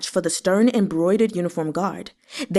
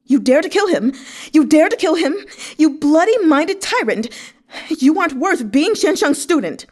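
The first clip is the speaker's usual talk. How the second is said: much louder, higher furious screaming; spat relentlessly; 8 semitones higher, 5 dB louder than usual. A narrator's reading. Xue Xiu Zhuo screaming abuse at Shan Zichuan, furious and loud. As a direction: hurl abuse with everything you have. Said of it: "spat relentlessly".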